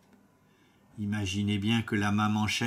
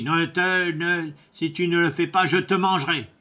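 wolf's threat; 5 semitones higher, 6 dB louder than usual. Acted. Wolf threatens the miller.

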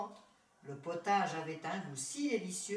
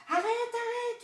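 very high, loud panicked scream; old woman's frantic high shriek; terrified yell; 8 dB louder than usual. A narrator's reading. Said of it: "hurla la vieille femme terrifiée".

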